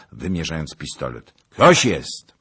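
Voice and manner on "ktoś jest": loud, high challenge; shouted challenge. A narrator's reading. Zagloba challenges the rider at pistol point, loud shout. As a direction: call out hard to the stranger.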